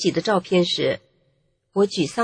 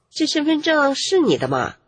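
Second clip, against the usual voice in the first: mildly doubtful, higher-pitched question; 6 semitones higher than usual. A narrator's reading. Policewoman checking the narrator's ID card, mildly doubtful. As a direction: read the question with a touch of suspicion. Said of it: "女警怀疑地问我".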